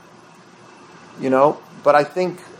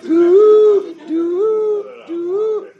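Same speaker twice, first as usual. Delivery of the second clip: three high sung horn notes; three broken horn calls, high; three shofar blasts; 6 dB louder, much slower than usual. Shown as a demonstration. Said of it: "What's a Shvarim?".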